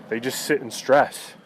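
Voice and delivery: ordinary delivery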